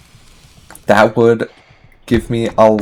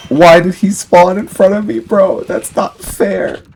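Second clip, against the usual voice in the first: high-pitched indignant whine; 8 semitones higher, 6 dB louder than usual. Real, not acted. Complaining about unfair spawn.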